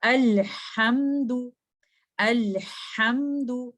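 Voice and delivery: "अल्हम्दु" slow with an effortful breathy ha. Shown as a demonstration. Teacher showing effortful ha, slow. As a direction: push breath through the ha of "अल्हम्दु". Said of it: "थोड़ी सी effort के साथ".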